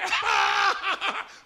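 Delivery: high scornful laugh; mocking laugh. Old friend laughs at the preacher, high and scornful. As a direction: laugh in his face. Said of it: "él se reía de mí".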